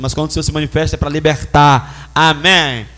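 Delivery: preaching climax. Real, not acted. Preacher building to a loud amen for the church.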